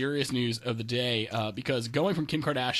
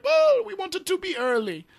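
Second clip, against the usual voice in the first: high, loud mock-French outburst; hammed-up French accent; terrible French accent; 6 dB louder than usual. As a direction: do a bad French accent, pitched way up. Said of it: "that was my terrible French accent".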